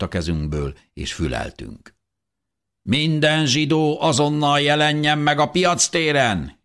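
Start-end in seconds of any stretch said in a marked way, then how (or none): 2.8-6.6 high, loud sustained proclamation; town crier's shout. A narrator's reading. Town crier summons everyone to the market square, shouting. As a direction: call it out across the streets, high and loud. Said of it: "kiáltotta".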